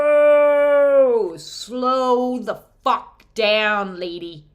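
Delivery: a loud "Whoa", then a slow, drawn-out "slow the fuck down"; feminine energy scolding. Acted. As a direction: rein her in, slow and stretched, like a stern goddess. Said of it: "the feminine is coming in to say".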